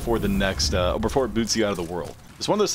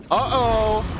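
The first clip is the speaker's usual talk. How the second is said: high, sing-song uh-oh; a mock-worried high uh-oh; playful uh-oh rising higher; 11 semitones higher, 3 dB louder than usual. Real, not acted. Gaming, rising alarm.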